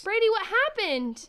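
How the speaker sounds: high, loud and slow; frightened call. Acted. Sibling calls to Brady after the crash, loud frightened call. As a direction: call out in alarm.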